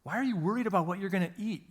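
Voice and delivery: mock indignation, pitched high; incredulous questioning, voice jumping up in disbelief; Jesus. Jesus to his forgetful disciples, acted-out indignation. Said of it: "there's this point of indignation".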